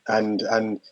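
ordinary delivery

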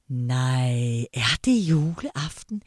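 put-on low, slow old granny asking in wonder; grandmother's voice. Grandma asking her grandchildren if it's Christmas Eve, low and slow. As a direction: draw it out like a muddled, delighted grandma.

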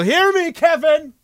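high call to someone in back. Acted. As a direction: call his name toward the back room. Calls to Kevin in the back.

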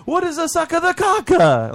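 very high, loud mock Italian voice; imitating the Italian guest. Imitating the confused Italian guest in a squeaky, loud mock accent. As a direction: squeak out his baffled question. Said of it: "he's like".

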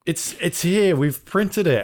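breathless, high, excited announcement; mock-excited employee with the disc.

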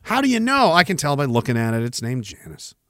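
ordinary talk, nothing marked

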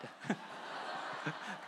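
soft, mostly voiceless laugh. Real, not acted. Preacher realizing his analogy makes him God, soft laugh. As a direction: laugh quietly at your own slip.

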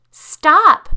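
very loud, high terrified scream. Acted. Lisa screaming at Tanya to stop the car. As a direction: scream it with everything. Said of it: "Lisa screamed".

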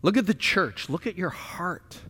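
normal talking voice

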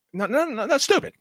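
high-pitched, exasperated objection; exasperated dismissal. Real, not acted. Cohost objecting to a wrestler's kept accent, exasperated. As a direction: protest in a voice shooting way up.

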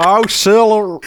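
put-on Western accent. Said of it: "Yeah, you're right. That is".

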